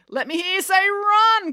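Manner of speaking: high, drawn-out hype-man call. A narrator's reading. Brad rallying the crowd to answer, calling out. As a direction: whip the crowd up. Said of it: "called Brad".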